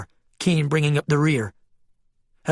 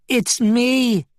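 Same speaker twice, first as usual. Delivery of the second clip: higher, imploring sheep voice; pleading; 9 semitones higher than usual. A narrator's reading. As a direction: beg to be recognized. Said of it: "the Bryn sheep pleaded".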